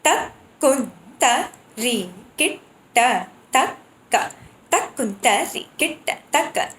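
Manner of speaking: same phrase slow, then at medium speed; slow then medium tempo. Shown as a demonstration. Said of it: "Vilambit Lai, Madhya Lai".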